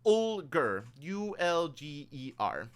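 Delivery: "Ulger" said firmly, then spelled slowly letter by letter; name spelled out. Shown as a demonstration. Game master clarifying "Ulger" for a player, said firmly and spelled slowly. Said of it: "Mister Ulger?".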